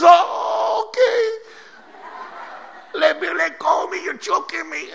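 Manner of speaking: high strained voice, being choked; choked angel voice. Acted. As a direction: squeal like someone choking.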